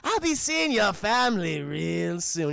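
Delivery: theatrical, mocking villain menace; hammed-up gloating, loud at the peaks; Joker. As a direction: start high and amused, draw out the threat. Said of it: "And Joker's like".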